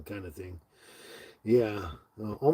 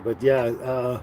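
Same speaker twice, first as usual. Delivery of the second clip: ordinary delivery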